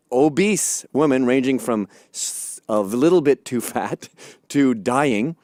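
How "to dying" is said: normal talking voice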